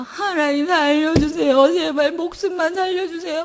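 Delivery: sobbing prayer, slow on 살려주세요; crying begging prayer; wailing plea. Retold or imitated. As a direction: wail for your child's life. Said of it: "막 큰 소리로 울었어요".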